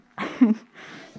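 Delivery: short breathy laugh; a quick amused laugh. Real, not acted. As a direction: laugh at the carpet. Amused by the old carpet.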